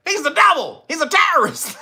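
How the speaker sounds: high, hammed-up accusation; mock outrage. Vlogger mocking the terror label with hammed-up outrage. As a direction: denounce him like a mock prosecutor.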